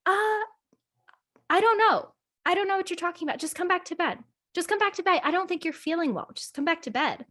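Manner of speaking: high-pitched, evasive imitation; put-on high voice, coaxing someone back to bed; changed demeanor. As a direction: imitate someone suddenly acting off. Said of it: "it's like his whole demeanor changed".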